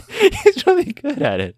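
high, slow, laughing insistence. Real, not acted. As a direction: giggle while insisting.

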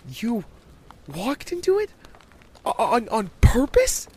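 put-on astounded child's halting question; shocked young girl. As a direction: question it in disbelief.

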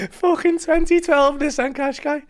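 high, slow, laughing disbelief; incredulous laughing. Real, not acted. Comedian to podcast host, laughing in disbelief at the Qashqai. Disbelief at his own costly mistake.

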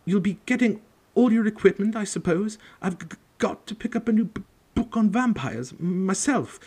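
high, stuttering scared teacher; nervous stammer. Acted. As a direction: stammer in fright. Quirrell nervous.

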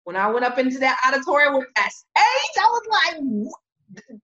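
loud, high burst of excitement; thrilled amazement. Retold or imitated. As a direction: build to an astounded cry. Reliving thrill at seeing the stage.

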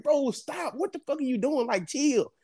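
retelling the panic in the car; panicked pleading. Retold or imitated. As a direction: plead high and urgent. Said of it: "I'm like".

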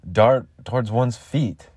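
slow ominous read; slow dramatic reading. Shown as a demonstration. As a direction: draw it out slowly. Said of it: "Like, what?".

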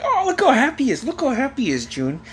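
high-pitched mock delight; imitated teasing dad. Dad showing grandma kid's sock disappointment, teasing gush.

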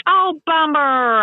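high, drawn-out letdown; mild disappointment. Retold or imitated. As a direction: stretch it out in mock disappointment. Retells her disappointed reaction.

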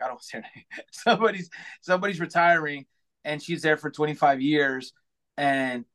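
ordinary delivery

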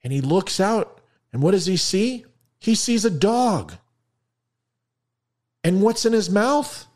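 joke punchline build-up. A narrator's reading. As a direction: tease the audience toward the punchline.

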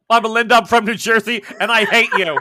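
loud, laughing mock of her rant; mocking Melinda. The game master teases the player, parroting her rant loudly while laughing. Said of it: "My name is Melinda".

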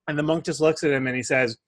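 ordinary talk, nothing marked

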